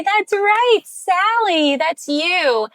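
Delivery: high, bright, loud praise. Shown as a demonstration. Parent praising the child who looked, high and excited. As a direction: cheer her on up high. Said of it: "you can say".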